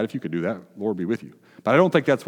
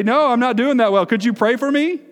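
higher, louder honest plea for prayer; 12 semitones higher, 6 dB louder than usual. Pretend. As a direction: ask for prayer openly, voice lifted. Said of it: "it's okay sometimes to say".

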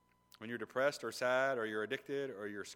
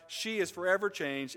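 usual speaking voice

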